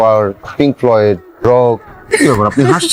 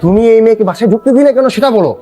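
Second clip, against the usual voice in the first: angry; 6 semitones higher than usual. Acted. Son confronts mother angrily.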